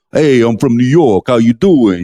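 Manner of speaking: hammed-up New York City accent; cartoonish New Yorker accent, badly faked; terrible New York accent. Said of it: "You know, a terrible accent".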